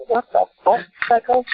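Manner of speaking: incredulous jab. Retold or imitated. Narrator glaring at the waving girl, high scornful disbelief.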